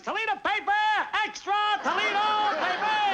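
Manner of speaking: high, loud newsboy cry, calling out the paper; newsboy yelling. Retold or imitated. A little newsboy on an island hawks his papers with a high, loud yell. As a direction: shout "Toledo paper" like a kid hawking papers. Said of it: "A little boy screaming at the top of his lungs".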